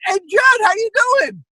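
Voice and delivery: high, bright-eyed "hey Judd" to cover embarrassment; flustered cheery greeting. Retold or imitated. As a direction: jump way up in pitch, all fake cheer. Caught out, greets Apatow.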